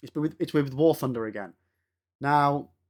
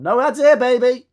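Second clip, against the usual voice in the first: gleeful boast; 8 semitones higher, 10 dB louder than usual. Real, not acted. Gloating about no ads.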